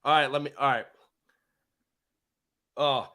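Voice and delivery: normal talking voice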